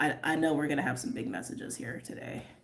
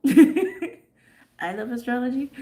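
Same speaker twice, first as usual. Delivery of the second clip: laughing gush; 8 semitones higher, 9 dB louder, much slower than usual. Real, not acted. Gushing about loving astrology.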